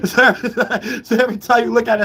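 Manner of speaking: high voice broken by laughter; real laughing fit while talking. Real, not acted. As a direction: try to talk through a laugh. Still laughing at circular reasoning.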